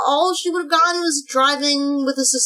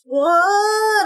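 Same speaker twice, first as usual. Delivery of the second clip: fake surprised what; 8 semitones higher than usual. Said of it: "That was the fakest".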